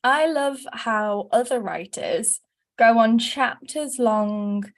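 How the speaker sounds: ordinary talk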